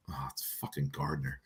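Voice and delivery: hushed whisper, almost no voice; retold whisper. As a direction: whisper it under your breath, deflated. Retold reply to son after draft pick.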